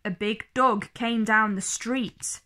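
usual speaking voice

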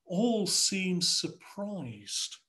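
normal talking voice

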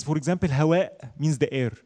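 usual speaking voice

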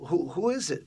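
lightly acted son pressing his mother; retold insistent question. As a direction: press mom for an answer.

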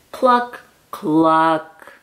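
ordinary delivery, nothing marked

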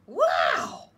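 big loud wow of wonder; thrilled slug seeing snails; amazed shout. Acted. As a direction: gasp it out loud like a wide-eyed kid.

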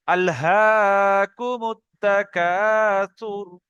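very slow, drawn-out chanted recitation. Shown as a demonstration. Teacher models reading the verse ten times, very slow recitation. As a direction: stretch each syllable, unhurried and exact. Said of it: "এইরকম আস্তে আস্তে".

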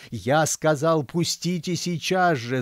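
high-pitched, slowing angry demand; angry yell. A narrator's reading. Old man ordering guard aside, angry yell. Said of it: "заорал старик".

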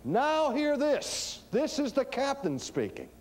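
high, drawn-out intercom call; ship's captain announcement. Retold or imitated. Captain getting on the intercom to the ship, commanding announcement. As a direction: announce it like a captain to all hands. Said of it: "captain of a ship getting on the intercom".

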